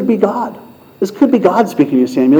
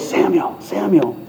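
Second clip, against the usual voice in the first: hushed, softer call of a name; 2 semitones higher, 8 dB quieter, slower than usual. Acted. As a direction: call the name softly, like from afar. God's voice calling a third time.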